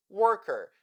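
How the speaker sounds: 'worker' with an American r-coloured ending; American accent. Shown as a demonstration. Teacher contrasting dialects for learners, American accent. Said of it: "in the American accent".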